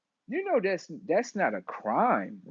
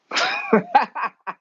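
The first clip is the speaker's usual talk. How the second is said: a big high burst of laughter; loud high laugh; 6 dB louder than usual. Real, not acted. Friend says people are robots, high loud laugh. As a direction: let out a loud squealing laugh.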